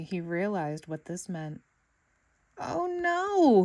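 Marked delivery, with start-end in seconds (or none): 2.6-3.6 high, loud wail of dismay. A narrator's reading. SpongeBob wailing as he realizes the teacher is gone. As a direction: cry it out in despair. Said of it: "he wailed".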